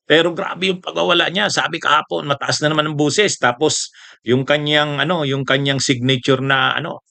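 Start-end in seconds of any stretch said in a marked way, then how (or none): none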